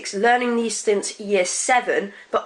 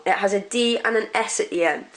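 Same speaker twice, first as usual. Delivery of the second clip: ordinary delivery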